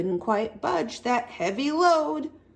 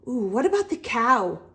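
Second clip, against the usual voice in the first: ordinary delivery, nothing marked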